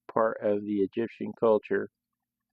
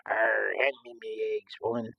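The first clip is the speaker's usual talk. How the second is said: pirate voice; 3 dB quieter than usual. Retold or imitated. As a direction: ham up an old sea dog. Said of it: "they're like".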